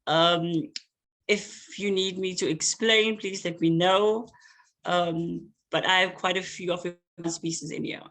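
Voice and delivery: ordinary talk, nothing marked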